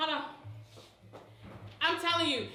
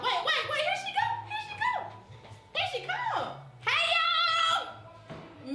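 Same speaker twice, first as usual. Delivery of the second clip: very high, laughing here she go; host greeting the arriving pastor; excited laughing squeal. Real, not acted. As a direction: squeal as your friend walks in.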